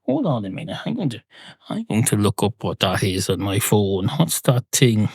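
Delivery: usual speaking voice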